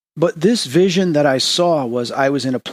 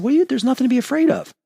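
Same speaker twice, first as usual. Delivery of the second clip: higher, quick retold comfort; reassuring; 12 semitones higher, faster than usual. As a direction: soothe the frightened girl, hurried. Comforting the crying girl.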